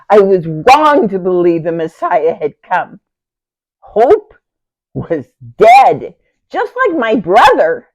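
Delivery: loud, anguished self-reproach; despairing outburst. Acted. Sister renounces hope in her dead brother, despairing outburst. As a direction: cry it out forcefully, hope gone.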